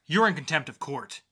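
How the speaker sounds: loud angry judge; furious ruling. Acted. Judge shouting at defiant Lucy. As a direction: slam the ruling down.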